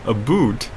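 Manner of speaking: "boot" with a long oo, falling at the end; Canadian-style vowel. Retold or imitated. Streamer trying out the Canadian about, Canadian-style vowel. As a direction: repeat "boot" with oo in place of ow. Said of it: "Canadians usually pronounce it a boot".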